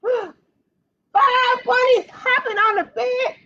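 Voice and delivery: gasp, then shout the big surprise; excited exclamation. Acted. Librarian reading to kids, a hammed-up excited reveal. Dramatic reveal in kids' story.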